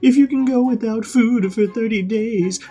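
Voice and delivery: very high, loud whiny threat; a spoiled brat threatening a swordsman; shrill bratty threat. Retold or imitated. Helmeppo threatening Zoro.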